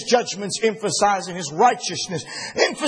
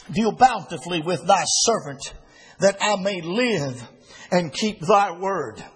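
solemn Bible reading; scripture reading; 4 semitones lower than usual. Acted. Reads scripture verse aloud.

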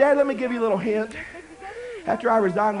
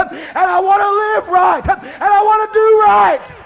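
preaching at full force; 7 semitones higher, 6 dB louder than usual. Acted. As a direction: preach it hard to the young ladies.

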